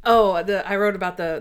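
usual speaking voice

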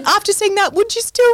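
high playful question; teasing. Real, not acted. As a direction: tease her cheekily.

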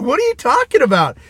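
high, amused disbelief; incredulous disbelief. Retold or imitated. Retelling his reaction to the host, amused disbelief. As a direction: ask it half laughing, stunned. Hearing Hitler claim, laughs.

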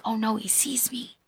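breathless frightened whisper, no voice; scared whisper. Retold or imitated. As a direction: whisper it like you've been caught. Woman fears being seen.

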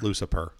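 Lucifer said as "Lucipurr"; punning pronunciation. Shown as a demonstration. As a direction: swap the f in Lucifer for a p, ending on "purr". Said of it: "I am the king of pun names".

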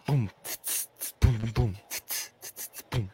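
soft mouth drum beat, boom and tss; quiet beatbox backing. Pretend. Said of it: "All right, ready, go".

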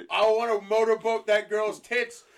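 very loud, high party-bro shout; mock bro king. King Eric enters in baseball cap, mock bro shout. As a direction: holler it like a hammed-up frat boy. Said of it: "comes out in a sideways baseball cap".

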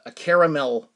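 ordinary talk, nothing marked

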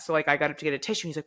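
ordinary talk, nothing marked

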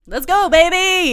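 shouted hype cheer; excited. Pretend. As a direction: pump up the crowd. Hyping up the tournament.

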